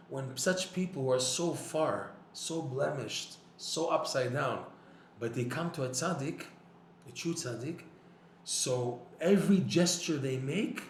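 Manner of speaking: ordinary delivery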